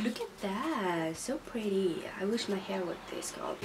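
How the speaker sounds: whispered, admiring. Real, not acted. Admiring the colours, she whispers softly. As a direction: breathe it out in awe.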